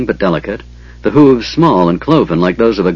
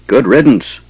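plain reading voice

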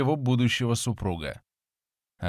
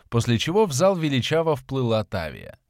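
ordinary delivery, nothing marked

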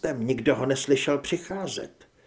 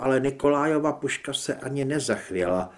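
ordinary delivery

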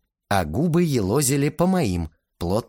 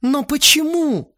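tearful why; 5 dB louder than usual. A narrator's reading. Lena tearful question.

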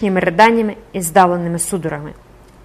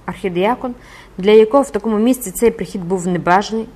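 usual speaking voice

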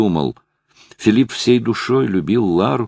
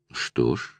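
сухо; 8 semitones lower, 9 dB quieter than usual. A narrator's reading. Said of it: "сухо проговорила Лара".

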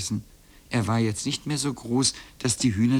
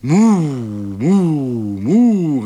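cows calling; 3 semitones higher, 9 dB louder, much slower than usual. A narrator's reading. Three cows crying out at once, mooing. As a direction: moo long and loud like a herd. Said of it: "riefen alle drei auf einmal".

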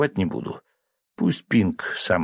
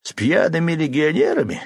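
smirking; 7 semitones higher than usual. A narrator's reading. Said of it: "усмехнулся вышибала".

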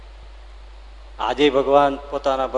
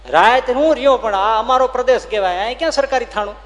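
higher and louder, challenging; raised voice disputing him; heated disbelief; 9 semitones higher, 6 dB louder than usual. Acted. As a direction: argue it can't be true. Horseman disputes the story.

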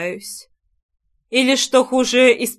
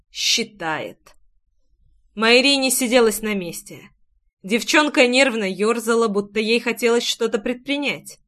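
usual speaking voice

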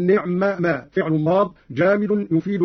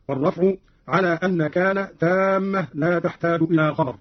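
ordinary delivery

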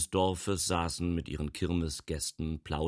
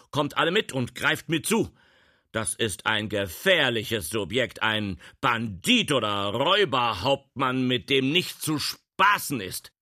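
loud, raised-pitch warning; rally the whole room to seize him; urgent rallying call; 5 semitones higher, 6 dB louder than usual. Acted. Official rallies everyone against suspect. (alarmiert und laut befehlend)

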